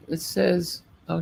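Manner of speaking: usual speaking voice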